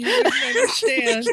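high, laughing delivery; laughing teasing line; laughing quip. Pretend. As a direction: toss it off while cracking up. Joking completion.

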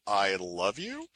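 "you?" lifted as a question, low and slow; "I love you?" read flat and slow, pitch jumping up on "you"; question rise. Retold or imitated. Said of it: "it reads it as a question, so it increases the pitch".

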